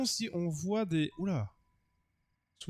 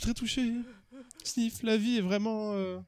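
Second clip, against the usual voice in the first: higher, mock-tearful character voice; moved suitor; 7 semitones higher than usual. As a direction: play a lover overcome with gratitude.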